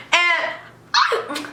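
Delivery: normal talking voice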